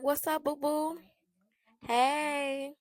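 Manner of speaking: high, mock-sweet greeting; taunting. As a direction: needle them with a sugary, singled-out hello. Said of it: "I'm just taunting this bitch".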